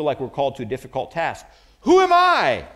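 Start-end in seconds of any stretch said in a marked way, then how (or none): 1.8-2.7 high, loud, strained cry of self-doubt; Moses' cry. Retold or imitated. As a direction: throw the question up in despair. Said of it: "when Moses asked this question".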